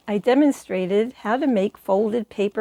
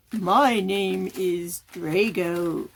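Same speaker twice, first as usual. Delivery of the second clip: slow, low dragon puppet; 3 semitones lower, much slower than usual. Shown as a demonstration. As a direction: dragon answering the interviewer. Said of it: "the puppet interview".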